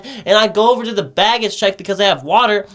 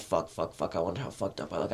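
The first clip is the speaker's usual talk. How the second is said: much softer and lower, worried inner mutter; barely voiced fretting to himself; hushed inner voice; 17 dB quieter than usual. Narrator to himself on the stairs, hushed and much softer. Inner thought while too high.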